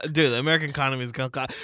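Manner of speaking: normal talking voice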